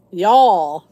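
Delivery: loud, playful Southern "y'all"; joking Southern drawl. Pretend. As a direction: toss out a mock Southern accent. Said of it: "I say it now just to be funny".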